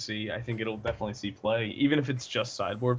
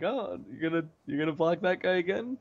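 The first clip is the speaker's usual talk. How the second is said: higher-pitched mock challenge; mock taunt; 7 semitones higher than usual. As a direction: taunt an opponent in a high, playful voice. Mock taunt while eating opponent's creatures.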